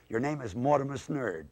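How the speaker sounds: ordinary talk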